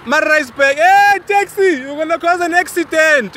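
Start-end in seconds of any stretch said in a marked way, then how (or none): 0.8-1.8 slow, high call to a taxi; hailing a taxi. Real, not acted. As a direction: hail the taxi from afar. Calling out to a taxi.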